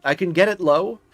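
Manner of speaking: ordinary talk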